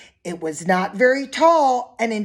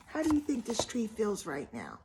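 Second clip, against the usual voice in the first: soft, gentle question to the listeners; hushed aside; 14 dB quieter, faster than usual. Acted. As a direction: ask the kids softly. Quiet question to the children.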